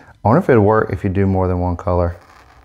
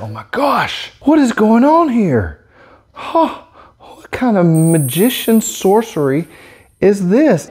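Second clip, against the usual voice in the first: high, awed, drawn-out amazement; wide-eyed wonder at sorcery; astonished; 10 semitones higher, slower than usual. Real, not acted. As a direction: gasp at the magic trick. Amazed by marker effect.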